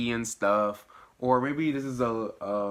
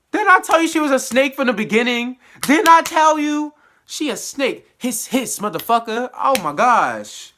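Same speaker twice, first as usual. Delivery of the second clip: high, loud indignant ranting; vindicated outburst; 12 semitones higher, 9 dB louder than usual. Real, not acted. Viewer calling Nandy a snake after her betrayal, indignant yelling. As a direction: yell it like a told-you-so.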